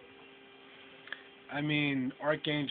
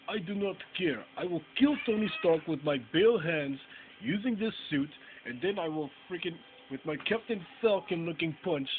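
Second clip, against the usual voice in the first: hammed-up Russian accent, pitched higher; 5 semitones higher than usual. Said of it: "terrible Russian accent".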